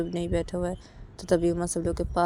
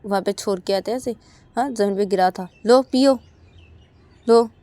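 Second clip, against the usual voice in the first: louder, scornful taunt offering the cigarettes; angry taunt; 3 semitones higher, 8 dB louder than usual. Acted. Uma taunts Sanju, handing back his cigarettes, scornful and loud. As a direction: shove the packet at him with contempt. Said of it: "Sanju को बोलती है कि".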